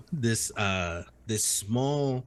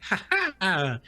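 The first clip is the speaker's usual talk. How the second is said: mock laugh; 7 semitones higher than usual. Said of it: "and he's like".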